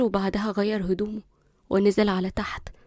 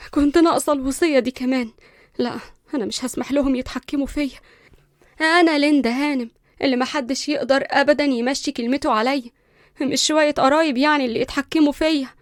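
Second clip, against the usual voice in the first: louder, higher, spiteful defiance; resentful; 4 semitones higher, 5 dB louder than usual. Acted. Linda alone after the lawyer leaves, resentful and defiant. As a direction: spit out the defiance. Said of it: "قالت بغيظ".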